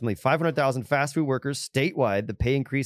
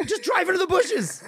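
much higher, pleading shout; exasperated yell. Retold or imitated. Friend yelling at the movie character, exasperated and high. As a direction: yell the obvious fix at the screen. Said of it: "I'm like".

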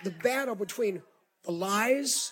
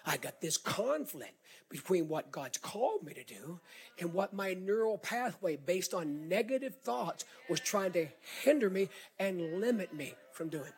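ordinary talk, nothing marked

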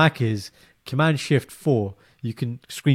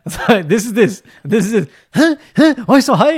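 high, loud squeaky questioning; mock puzzled client; 9 semitones higher, 8 dB louder than usual. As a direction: ham up the confusion over the bill. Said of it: "I was like".

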